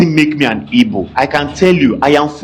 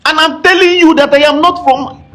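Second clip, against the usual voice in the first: high, loud, indignant protest; Are you crazy, thrown out in disbelief; exasperated outburst; 14 semitones higher, 3 dB louder than usual. Real, not acted. Speaker protesting to those who lecture him, exasperated high outburst. Angry rant at being lectured.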